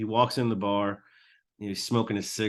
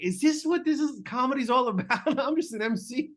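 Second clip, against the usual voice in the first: much higher, wide-eyed awe; amazed disbelief; 12 semitones higher than usual. Retold or imitated. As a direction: gasp it out like a stunned rookie. Young MC stunned by the scene.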